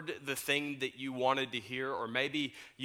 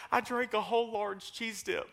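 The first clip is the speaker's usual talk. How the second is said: laughing through quote; 8 semitones higher than usual. Retold or imitated. Retold friend's confession, laughing.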